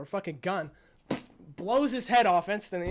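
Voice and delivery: normal talking voice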